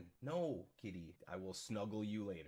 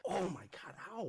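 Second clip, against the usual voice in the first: ordinary talk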